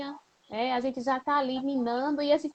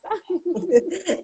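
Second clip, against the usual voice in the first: laugh; 7 semitones higher, 9 dB louder than usual. Real, not acted. Interviewer laughs after asking.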